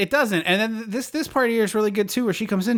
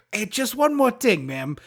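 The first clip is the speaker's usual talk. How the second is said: slow, put-on detective impression; Columbo; 3 semitones higher, slower than usual. Detective questions wife about her car, mock-casual Columbo voice. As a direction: politely needle the suspect, drawing out the words. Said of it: "it doesn't take Columbo to solve this one".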